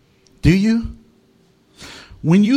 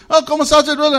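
higher, louder friendly Spanish greeting; Mexican locals; 8 semitones higher, 3 dB louder, much faster than usual. Retold or imitated. Locals greeting the pastor in Spanish, higher and friendly. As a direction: greet him like a buddy on the street. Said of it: "And then they ask me".

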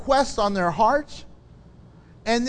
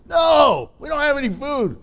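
shouted reply; 5 semitones higher, 3 dB louder than usual. Acted. Fishermen shouting back.

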